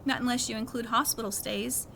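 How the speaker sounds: plain reading voice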